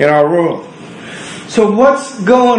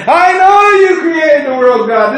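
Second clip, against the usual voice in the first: much higher and louder, pleading with God; frustrated believer; 10 semitones higher, 4 dB louder than usual. Acted. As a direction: cry out to God in exasperation. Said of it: "This is what we say".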